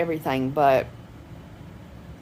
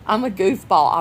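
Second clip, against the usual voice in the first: laughing at herself; 8 semitones higher than usual. Real, not acted.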